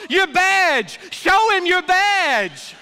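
very loud, high yell, slowing on the last words; yelling rancher. Acted. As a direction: shout it across the field at the top of your lungs. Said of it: "he yells at the top of his lungs".

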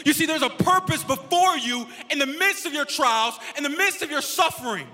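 loud, punchy preacher with stretched key words; full-force preaching. Real, not acted. Pastor telling sufferers there is a purpose, loud preaching. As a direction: drive each phrase home to the congregation.

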